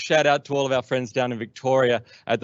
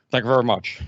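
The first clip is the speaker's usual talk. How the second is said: [name] impression. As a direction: thank them like [name] leaving the stage. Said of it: "He goes".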